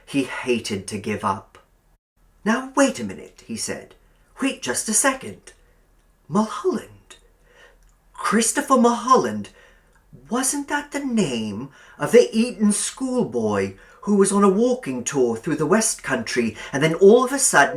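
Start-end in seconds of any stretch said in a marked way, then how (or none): 2.4-3.4 sudden recollection. A narrator's reading. Billy straining to remember. 4.4-17.8 put-on higher, louder, eager young man piecing it together; eager young Billy. A narrator's reading. Billy half-recalling a newspaper story, eager and higher.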